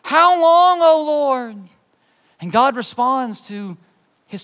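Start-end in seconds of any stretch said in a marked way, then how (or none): 0.0-1.6 high, slow, drawn-out plea; crying out. Acted. Struggling believer praying to God, crying out. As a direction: cry it out with raised voice. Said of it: "you just cry out".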